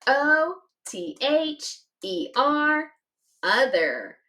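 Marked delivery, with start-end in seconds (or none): 0.1-2.9 slow, tapping out each letter; spelled letter by letter. Shown as a demonstration. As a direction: sound out each letter slowly. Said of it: "Do it with me".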